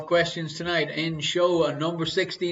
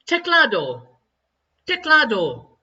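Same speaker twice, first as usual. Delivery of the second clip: dramatic Spanish word; 5 semitones higher, 6 dB louder than usual. Pretend.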